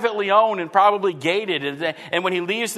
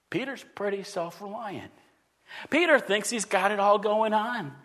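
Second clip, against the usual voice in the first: ordinary talk, nothing marked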